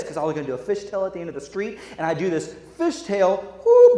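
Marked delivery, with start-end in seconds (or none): 3.6-4.0 high loud whoop; a thrilled yelp mid-turn. Retold or imitated. Boy fishtailing go-kart, thrilled whoop.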